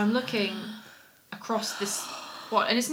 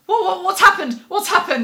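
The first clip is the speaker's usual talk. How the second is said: high, loud, laughing bewilderment; alarmed confusion; 9 semitones higher, 11 dB louder than usual. Retold or imitated. Startled woman asking the shouting man what happened, laughing alarm.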